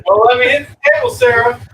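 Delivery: loud call over a running engine; shouting over engine. Real, not acted. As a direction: yell it so the driver hears. Yelling to driver over engine.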